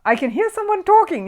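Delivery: high, loud, amazed; surprised announcement. A narrator's reading. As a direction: announce it wide-eyed. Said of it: "he announced".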